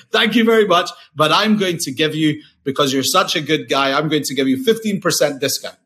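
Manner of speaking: a hint of an Indian accent, friendly and generous; playful Indian accent impression of the waiter. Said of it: "I can't help put on the accent".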